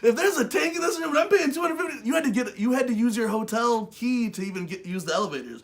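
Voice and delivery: high and loud, building the complaint; a loud mock-outraged voice; outraged rant. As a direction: rant about paying for the room. Continuing the rant.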